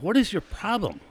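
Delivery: angry driver yelling in the pits; retold yelling. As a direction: shout it back at the guy with the crowbar. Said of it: "I said".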